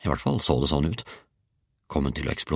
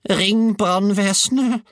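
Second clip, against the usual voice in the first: very high, drawn-out cry for help; sudden shout; 4 dB louder, slower than usual. A narrator's reading. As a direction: cry out slowly in a high old-woman voice. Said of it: "ropte hun plutselig".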